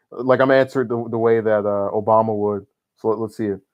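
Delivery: ordinary delivery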